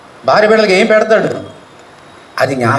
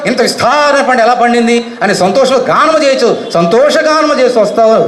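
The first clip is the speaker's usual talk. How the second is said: higher, rising preacher voice speaking as God; voicing God's promise; 6 semitones higher than usual. Preacher voicing God's promise to the congregation, raised and uplifting. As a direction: lift the voice as God comforting the weeping. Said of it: "ఆయన చెప్తున్నాడు నీతోటి".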